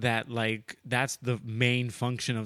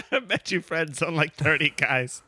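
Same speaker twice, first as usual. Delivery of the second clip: laughing, louder, repeating the jab; amused echo; 4 semitones higher, 5 dB louder than usual. Real, not acted. Friend laughs and echoes the Reddit jab, amused repeat. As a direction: relish the line through laughter. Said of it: "laughs, repeats".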